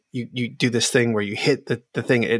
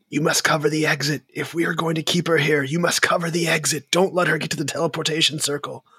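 urgent character voice; 5 semitones higher, much faster than usual. Acted. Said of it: "looks back and, and says".

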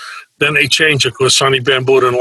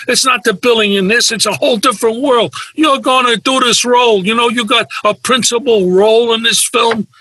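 scolding a clueless client on the phone; exasperated agent; 8 semitones higher than usual. Retold or imitated.

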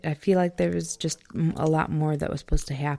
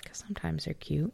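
whispering; 9 dB quieter than usual. Real, not acted. Joking aside to partner.

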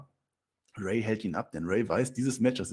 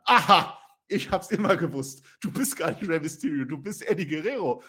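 gotcha accusation; 5 semitones higher, 4 dB louder than usual. Acted. Mrs Mysterio accusing.

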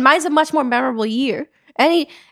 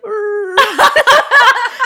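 loud high laugh; 10 semitones higher, 6 dB louder than usual. Real, not acted. Recalling the Jenga video, a loud high laugh.

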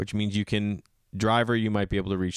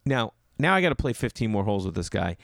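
normal talking voice

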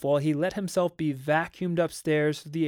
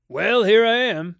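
loud, higher-pitched arrival call; hearty announcement; 7 semitones higher, 10 dB louder than usual. A narrator's reading. McLean arriving at the nursery, hearty announcement. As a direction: announce yourself loudly from the doorway. Visitor announces himself in doorway.

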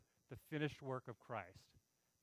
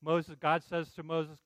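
normal talking voice

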